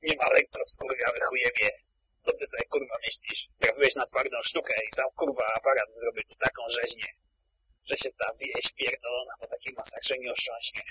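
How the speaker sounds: menacing words pushed through gritted teeth; a hint of a tough gangster, low and quiet. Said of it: "wycedza przez zęby swoim męskim, straszącym głosem".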